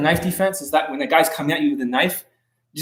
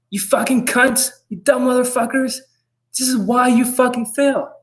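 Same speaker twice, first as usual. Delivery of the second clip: much higher, slow ranting tirade; Dan Pena impression; 10 semitones higher than usual. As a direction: rant at failures like a furious mogul. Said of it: "Does it sound like Dan Pena".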